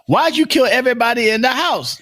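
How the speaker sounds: lightly acted, high incredulous question; joke setup voice.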